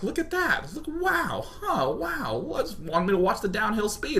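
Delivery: high, soft, airy "wow"; mock amazement. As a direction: coo high and quietly in pretend wonder. Mocking reaction to road signs.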